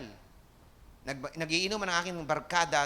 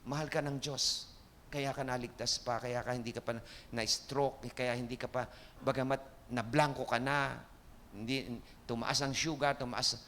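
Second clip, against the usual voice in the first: gentle comfort; 5 semitones lower, 5 dB quieter than usual. Retold or imitated. Preacher telling his recovered brother God loves him, gently. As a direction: tell him tenderly, one-on-one. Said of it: "At sinabi ko".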